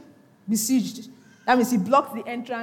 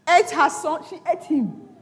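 ordinary delivery

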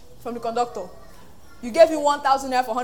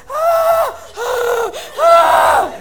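high loud shouting "ah"s; shout of Jericho; 12 semitones higher, 9 dB louder, much slower than usual. Pretend. Israelites shouting at Jericho walls, high loud roar. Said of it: "So they went".

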